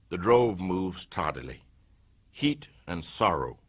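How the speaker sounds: ordinary delivery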